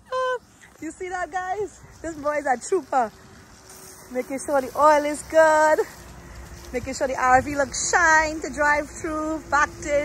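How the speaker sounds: ordinary talk, nothing marked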